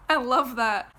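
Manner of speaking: loud laughing I love that; laughing delight. Real, not acted. Vlogger exclaiming over the metaphor, laughing loud. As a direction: burst out with it.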